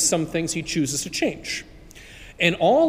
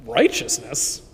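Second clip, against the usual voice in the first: lower, puzzled echo of the word; taken aback; 5 semitones lower than usual. Acted. The man, taken aback by the voice's offer, lower and puzzled. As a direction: repeat the word, caught off guard. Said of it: "the guy was taken aback".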